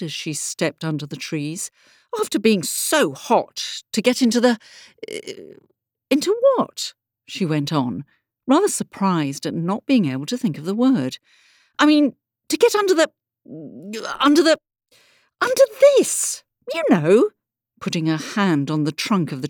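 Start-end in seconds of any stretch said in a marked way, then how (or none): 2.2-6.9 higher voice, faltering into a surprised question; puzzled Alice. Acted. Alice losing her word under the trees, puzzled. 11.8-17.3 high, louder, halting and rising in frustration; flustered word-searching. Acted. Alice unable to name the tree, flustered.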